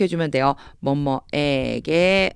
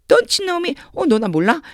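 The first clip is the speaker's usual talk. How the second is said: high-pitched, acted surprise at not being recognised; surprised street question; 10 semitones higher, 4 dB louder, slower than usual. Someone unrecognised by an acquaintance in the street, surprised question. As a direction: call it out high, like bumping into an acquaintance. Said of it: "길 가다가".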